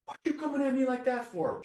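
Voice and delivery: very high, defensive retort; high defensive outburst at the teacher; reactive kid. Shown as a demonstration. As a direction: snap back, hurt and reactive. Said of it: "angry, agitated, emotionally reactive".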